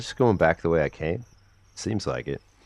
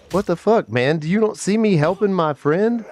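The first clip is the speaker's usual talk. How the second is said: much higher, louder exasperated protest; indignant outburst; 11 semitones higher, 4 dB louder than usual. Real, not acted. Gamer attacked while helping his friend, high indignant outburst. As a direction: complain at the attacker.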